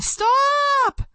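high, loud shout; girl screaming at Sal to stop; screamed. Acted. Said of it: "I screamed".

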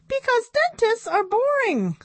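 high puppet voice landing a punchline; very high monkey comedian. A narrator's reading. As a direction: deliver it as a squeaky monkey puppet.

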